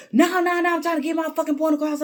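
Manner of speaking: high, loud, fast furious shout; a hammed-up ranting man; mock angry yell. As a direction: imitate the man cutting her off. Man yelling back in argument.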